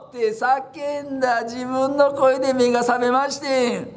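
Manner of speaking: ordinary talk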